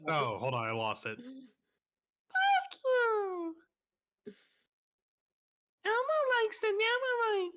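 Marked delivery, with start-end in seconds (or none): 1.2-7.5 very high squeaky puppet voice; Elmo voice. Pretend. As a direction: squeak it out like a muppet. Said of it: "No, I lost it".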